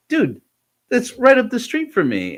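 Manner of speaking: high, bright surprise at the coincidence; almost squealing with amazement; excited disbelief. Retold or imitated. Surprised friend nearby.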